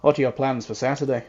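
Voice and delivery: ordinary delivery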